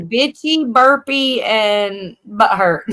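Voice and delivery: very slow, drawn out; slow punchline. Real, not acted. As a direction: stretch out the last words.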